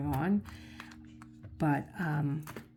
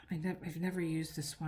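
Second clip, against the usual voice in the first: normal talking voice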